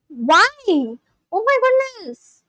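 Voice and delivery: high, drawn-out cry of dismay; appalled. Real, not acted. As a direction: stretch it out, pitch leaping up in horror. Appalled at reading about atrocities.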